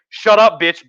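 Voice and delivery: sudden loud put-down, played for laughs; mock brusque retort. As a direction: act out a rude brush-off. Acted British brush-off.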